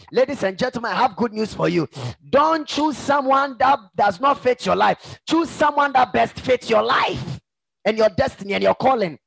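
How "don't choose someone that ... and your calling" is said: high, emphatic preacher's exhortation; forceful preaching. Acted. Preacher advising the congregation on partners, forceful preaching. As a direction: press the advice home with raised pitch.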